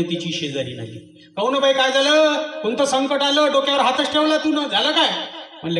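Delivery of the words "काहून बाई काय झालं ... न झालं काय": curious neighbour voiced high; pry with a raised voice; high-voiced neighbour woman. Neighbour woman asks the worried wife, high and curious.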